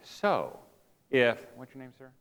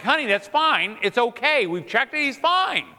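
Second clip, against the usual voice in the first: high, loud, insistent reassurance; mock exasperation, pitch climbing to the end; exasperated husband; 10 semitones higher, 5 dB louder than usual. Woken husband to crying baby's worried wife, exasperated.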